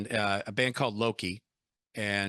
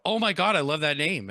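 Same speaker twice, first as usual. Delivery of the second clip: gushing; 6 semitones higher, 6 dB louder than usual. Retold or imitated. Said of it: "And they go".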